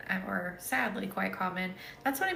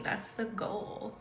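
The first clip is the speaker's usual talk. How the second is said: usual speaking voice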